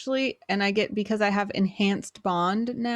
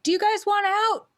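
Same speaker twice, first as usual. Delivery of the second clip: calling out; 11 semitones higher, 4 dB louder than usual. Acted. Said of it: "Can I quickly be like".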